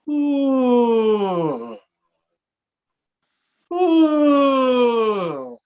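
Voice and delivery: high uh sliding down; descending glissando. Shown as a demonstration. Teacher demonstrating a cool-down glide, sliding downward. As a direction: glide down from the top on uh. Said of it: "Ahí dice un glissando descendente".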